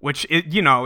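ordinary delivery

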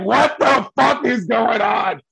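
stunned outburst. Retold or imitated. The boy reacting to the man groping his dad, slow stunned shout. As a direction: blurt it out in disbelief. Shocked boy's reaction retold.

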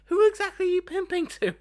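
very high, incredulous squawk; mock outrage. Podcaster challenging the pimp's threat about the kid, mock outraged. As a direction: question it in high-pitched disbelief.

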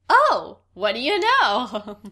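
wide-eyed mock wonder; mock amazement. Alice voiced, mock amazed.

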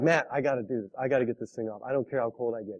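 low, quiet mock-exhausted plea; retold plea, dropped low and soft; weary plea. Tired surfer begging his friend Matt for help, low weary plea.

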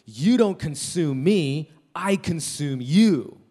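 drawn-out defiant shout, each word weighty; imitated yell. Preacher acting out man confronting a taco, imitated yell. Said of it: "he yells at the taco".

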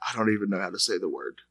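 whisper-like mourning of the episode; hammed-up depressed intro; mock hushed tone. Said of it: "I'm sorry about this hushed tone".

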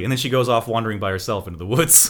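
ordinary talk

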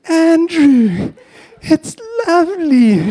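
high, drawn-out motherly gush with a cry in it; mum's gushing praise. Retold or imitated. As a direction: coo over the ugly carving like a doting mum. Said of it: "she says".

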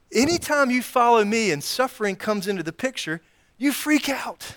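a playful scolding Jesus; mock exasperation. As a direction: poke fun at their panic.

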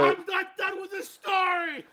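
loud, high drawn-out yell; angry guy. Acted. Angry guy defending his unfinished story, drawn-out yell. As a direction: shout over the interrupter, exasperated. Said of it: "you're the angry guy who didn't make the".

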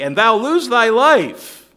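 loud, slow, high menace; menacing threat. Retold or imitated. Danites threatening Micah's life, loud and slow. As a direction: lean into the threat slowly.

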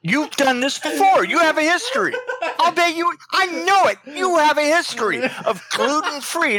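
high, indignant, amused accusing; mock accusation. Host accusing caller of gluten-free history, mock accusation. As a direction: press the charge with comic outrage.

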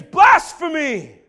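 slow, drawn-out, loud high scream; screaming high priest. Retold or imitated. Caiaphas condemning Jesus, slow loud scream. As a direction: tear into the word with outrage. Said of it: "tears his clothes and screams".

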